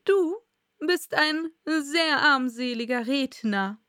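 slow royal put-down, loud first word; abschätziger König. Acted. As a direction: deliver a slow, scornful verdict. King scorns the Hatter. (langsam und abschätzig, als König)

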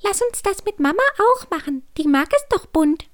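hohes, piepsiges Kükenstimmchen; peeping baby chick. Acted. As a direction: begeistert piepsend vorschlagen. Küken Clara schlägt vor. (piepsendes Küken)

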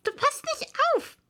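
high, scolding mouse voice; high-pitched strict reprimand; stern mouse. A narrator's reading. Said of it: "sagte die Maus streng".

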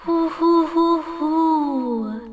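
high, loud spooky hoo-hoo; ghostly hooting. A narrator's reading. Little ghost calling from the throne room, hooting. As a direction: hoot like a little castle ghost. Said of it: "macht es in dem Moment wieder".

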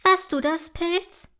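a touch of fright in the question; startled. A narrator's reading. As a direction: ask in timid surprise. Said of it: "fragt Milea erschrocken".

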